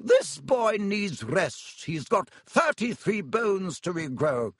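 high, indignant shout, drawn out then sharp; scolding matron's high-pitched outburst. A narrator's reading. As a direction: storm in and order everyone out. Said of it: "came storming over, shouting".

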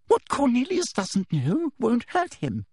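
high, slow, menacing woman's voice; excited official. A narrator's reading. Teacher dismisses the minister's law, slow and high. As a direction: draw it out, pitched high. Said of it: "was now panting slightly".

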